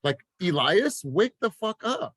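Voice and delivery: high, fired-up self-callout; passionate. Real, not acted. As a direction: scold yourself awake, pitch climbing. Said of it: "I'm just passionate about this".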